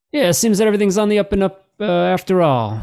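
high, fast officer voice; bribed officer. Acted. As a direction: play an officer waving it all through. Game master voices the bribed officer.

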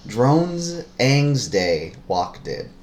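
word by word, spaced out evenly; deliberate clue reading. Shown as a demonstration. Reading a puzzle clue the partner must decode, slowly word by word.

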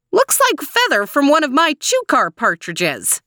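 put-on high-pitched old lady voice; screechy, pitched well up; screechy old woman. A narrator's reading. Old woman examining the feather, high and screechy. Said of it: "It was high and screechy".

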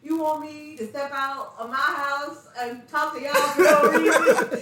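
high, loud indignant question through laughter; outraged disbelief, cracking up; laughing incredulous. Retold or imitated. Indignant retold quote, laughing.

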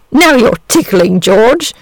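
a coy dragon being tickled. A narrator's reading. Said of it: "said the dragon coyly".

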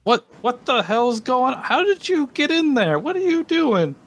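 high, startled captain demanding answers; a captain catching intruders, barking questions up high; angry captain. Acted. Ship captain confronts trespassers by the lock, high and indignant. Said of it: "the captain comes around the corner".

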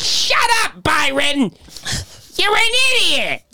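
very high, screeched Brooklyn grandmother; imitated grandma screaming. Grandson imitates Brooklyn grandmother scolding uncle, high-pitched scream. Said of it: "just scream at him".

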